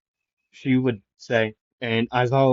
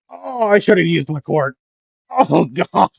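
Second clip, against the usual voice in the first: higher, mildly hurt moan; groaning in pain; 6 semitones higher, 4 dB louder than usual. Acted. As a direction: complain after a hard landing. Character groaning after painful fall.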